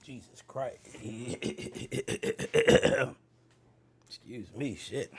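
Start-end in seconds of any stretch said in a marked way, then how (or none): 0.8-3.1 hacking cough; coughing fit; harsh cough. Real, not acted. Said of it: "I'm super congested".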